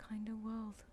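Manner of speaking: slow, breathless whisper of the name; soft amused whisper after a laugh. Shown as a demonstration. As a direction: hush it like a secret.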